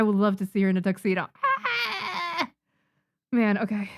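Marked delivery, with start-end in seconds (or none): 1.4-2.5 high delighted squeal; excited squeal. Real, not acted. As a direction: squeal with giddy joy. Overwhelmed by her own drawing.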